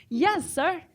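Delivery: usual speaking voice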